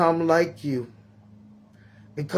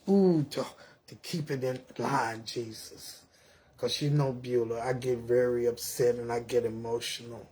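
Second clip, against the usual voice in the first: soft, low spoken prayer to Father God; 2 semitones lower, 6 dB quieter than usual. Acted. Grandmother praying softly to God before her talk. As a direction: pray it quietly and earnestly, pleading.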